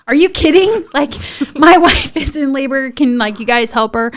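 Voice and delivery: high, loud mock protest, easing down; indignant disbelief. Husband pleading with hospital staff, indignant. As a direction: imitate an incredulous husband.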